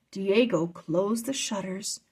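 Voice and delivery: ordinary delivery, nothing marked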